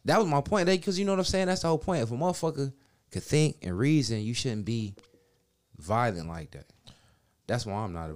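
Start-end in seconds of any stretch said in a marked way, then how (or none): 0.0-2.0 high, pressing insistence on the point; hammering home that was the point all along; emphatic insistence. Real, not acted. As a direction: drive the point home, pitched up. Insisting on his point, voice rising.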